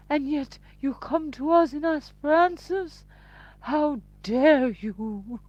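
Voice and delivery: high, loud theatrical indignation; pompous mock outrage. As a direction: build to a hammed-up wail of affront. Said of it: "They do sound so pompous".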